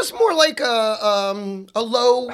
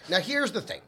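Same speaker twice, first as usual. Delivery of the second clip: usual speaking voice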